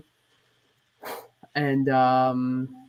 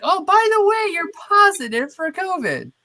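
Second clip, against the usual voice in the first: very high, loud, sing-song mockery; exaggerated high-pitched casual bombshell; mock email announcement; 7 dB louder than usual. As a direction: chirp it loudly as a cheery by-the-way. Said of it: "they sent me an email like".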